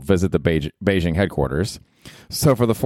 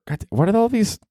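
exasperated. Real, not acted. Annoyed at hard names.

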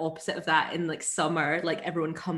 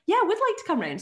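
higher, overly proper "Yeah, I would like to come round"; a playfully formal yes, pitched up; mock prim acceptance; 11 semitones higher, faster than usual. She recalls accepting his invite, mock polite and higher. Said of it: "And I was like".

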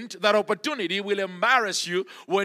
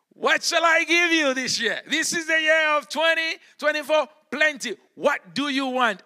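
raised, ringing offer from God; God addressing a believer; 7 semitones higher than usual. Acted. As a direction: put the question out high and emphatic, as God offering a gift. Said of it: "And he says to you".

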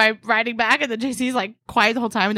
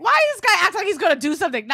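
high-pitched incredulous heckle; mocking, pitched-up disbelief; trolling; 9 semitones higher than usual. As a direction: re-enact jeering from behind a friend. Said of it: "J C thought I was trolling him".

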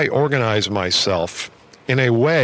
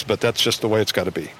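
normal talking voice

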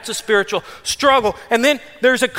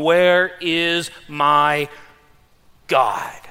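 very drawn-out, lower plea; slow lament; 7 semitones lower, much slower than usual. Acted. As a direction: stretch "Where is my God?" out slowly. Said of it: "they say to me all day long".

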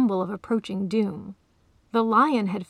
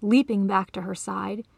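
ordinary delivery

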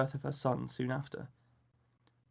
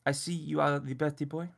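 put-on French accent. A narrator's reading. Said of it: "a strange Frenchman".

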